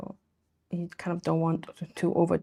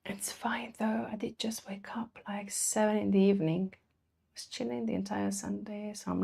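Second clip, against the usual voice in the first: whisper; 9 dB quieter than usual. Real, not acted. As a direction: hush it out as an aside. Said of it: "whispered aside after late night".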